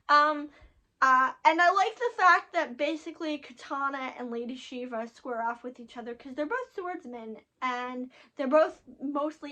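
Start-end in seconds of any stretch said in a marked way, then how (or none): none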